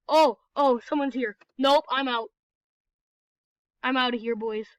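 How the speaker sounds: usual speaking voice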